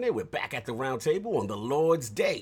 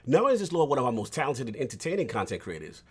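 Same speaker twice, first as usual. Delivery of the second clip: ordinary delivery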